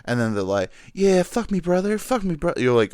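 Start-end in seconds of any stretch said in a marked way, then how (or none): 0.9-2.5 higher, louder breathy-style mock porn line; mock porn moan. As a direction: ham up a cheesy porn actress begging. Mocking porn actress lines.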